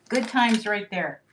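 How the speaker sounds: ordinary talk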